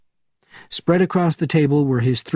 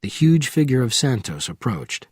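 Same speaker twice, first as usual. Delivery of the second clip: ordinary delivery, nothing marked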